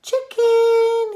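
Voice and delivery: high, loud coaxing call; high loud boy calling a hen. A narrator's reading. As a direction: coax the chicken over. George calls the hen.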